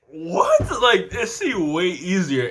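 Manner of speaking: high, stunned disbelief; can't believe how hard it is; shocked what. Retold or imitated. Shocked at hard warm-ups.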